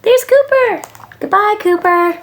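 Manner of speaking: put-on very high, childlike calling out; excited high-pitched little voice. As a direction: sing out to a pet in a small high voice. Child greets and waves off dog.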